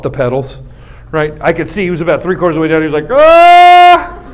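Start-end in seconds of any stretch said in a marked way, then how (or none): 3.1-4.2 loud high "ah" of a rider losing control; high mock scream; acted panicked yell. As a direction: yell out as if tipping over. Acts out crashing rider's cry.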